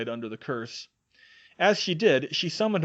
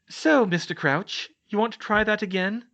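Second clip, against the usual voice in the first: smug; 10 semitones higher, 6 dB louder than usual. Acted. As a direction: savour the win, taunting the chained prisoner. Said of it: "Hermione asked smugly".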